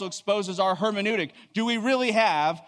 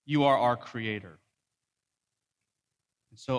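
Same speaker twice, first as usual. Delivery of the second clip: low, measured public prayer with long pauses; solemn prayer; 5 semitones lower than usual. Real, not acted. Preacher praying to the Lord before the message, solemn prayer. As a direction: pray reverently, phrase by phrase.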